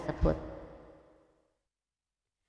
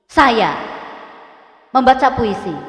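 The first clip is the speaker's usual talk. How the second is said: louder, firm falling on membaca; command intonation; 6 dB louder than usual. Shown as a demonstration. Teacher demonstrating command intonation, loud and falling. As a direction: order it firmly. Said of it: "Baca dengan intonasi yang benar".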